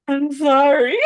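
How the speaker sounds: high, loud, hammed-up weepy apology; mock tearful. Wife apologizing to her husband, mock tearful. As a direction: retell it in an exaggerated crying squeak. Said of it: "And I started crying".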